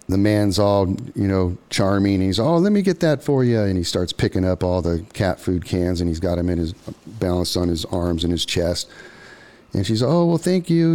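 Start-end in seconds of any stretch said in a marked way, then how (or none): 2.4-3.6 charming stranger. Retold or imitated. Stranger offers to carry the cans, high and sweet. Charming man voiced in story. 10.0-10.9 high, louder polite woman's voice. Retold or imitated. Woman thanks the stranger, high and polite.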